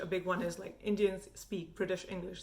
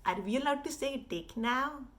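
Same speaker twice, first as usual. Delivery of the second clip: higher-pitched cartoon Indian accent; hammed-up Indian accent, pitched up; Apu-style Indian accent; 6 semitones higher than usual. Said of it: "Appu accent".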